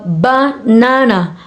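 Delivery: banana slowly, leaning on the middle NA; stress on second syllable. Shown as a demonstration. Teacher showing word stress, banana stressed on middle NA. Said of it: "the stress is on the second".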